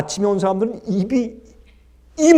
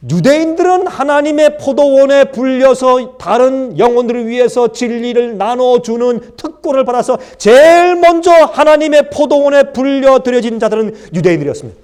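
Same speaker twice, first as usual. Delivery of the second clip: ordinary delivery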